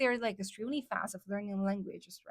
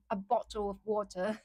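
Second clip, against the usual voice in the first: British accent. Shown as a demonstration. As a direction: say it like a mimicked Londoner. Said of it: "中国朋友就会学我的英音".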